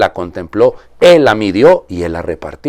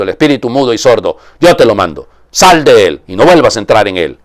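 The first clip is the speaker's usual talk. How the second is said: commanding exorcism; 2 semitones higher, 8 dB louder than usual. Acted. Jesus commands the spirit out.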